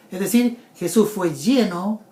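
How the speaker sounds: usual speaking voice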